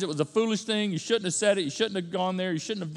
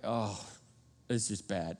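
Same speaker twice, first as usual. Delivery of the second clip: much lower and much softer, sinking into gloom; mock despair; 9 semitones lower, 9 dB quieter than usual. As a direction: let the voice drop as if defeated. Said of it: "And then depression".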